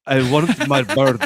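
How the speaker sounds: slow, loud mock-Russian accent; Mickey Rourke's Whiplash accent. Host mimicking a film villain's terrible accent, slow hammed-up Russian. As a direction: ham up a bad movie Russian accent, word by word. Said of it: "what's his name's accent?".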